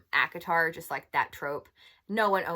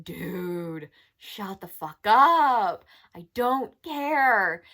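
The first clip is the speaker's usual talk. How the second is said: louder, slow, fed-up snap at a character; exasperated outburst; 8 dB louder, much slower than usual. Retold or imitated. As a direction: drag out the words, done with him.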